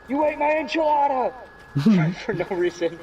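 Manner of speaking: high, loud outraged outburst; hammed-up manic yell; Crazy Steve. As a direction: shout the accusation like a sitcom lunatic. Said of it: "I've quoted Crazy Steve".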